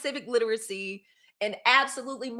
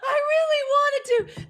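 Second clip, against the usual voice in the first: squeaky high exclaim; 6 dB louder than usual. Real, not acted. Exclaiming at a comment.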